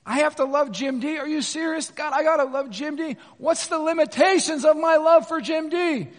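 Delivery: high, loud mock disbelief, building; incredulous protest. Congregant protesting to the Lord about loving someone, incredulous. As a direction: complain to God in hammed-up dismay. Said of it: "here's the other thing we're saying".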